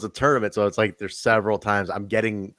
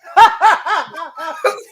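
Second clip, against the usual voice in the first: mock shrieking laugh, much higher; imitating a loud laugh; 6 dB louder than usual. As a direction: imitate her super loud laugh in the background. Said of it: "super loud".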